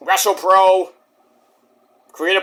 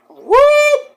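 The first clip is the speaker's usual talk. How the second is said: high, loud whooping sound effect; a quick cartoon whoop; 7 dB louder than usual. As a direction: voice the flying fall as a whoop. Imitating Candice's fall.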